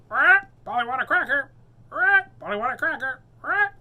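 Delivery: high, loud squawking parrot voice; shrill-free squawk, high and loud. Acted. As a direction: screech it like a nagging parrot. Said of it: "Polly Parrot squawked into the pirate's ear".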